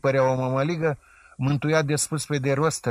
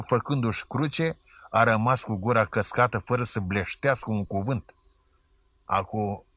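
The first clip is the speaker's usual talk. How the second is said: usual speaking voice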